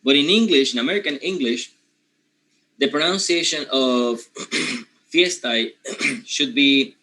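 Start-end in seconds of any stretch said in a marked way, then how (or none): none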